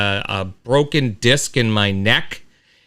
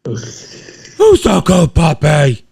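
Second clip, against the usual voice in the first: talking to a dog; 3 semitones higher, 4 dB louder, much slower than usual. Real, not acted. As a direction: draw it out for the dog.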